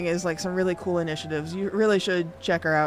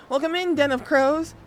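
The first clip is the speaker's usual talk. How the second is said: high, louder cheery hello; excited greeting; 6 semitones higher, 4 dB louder than usual. Real, not acted. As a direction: bubbly welcome. Greeting second raid.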